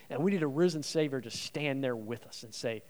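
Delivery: ordinary talk, nothing marked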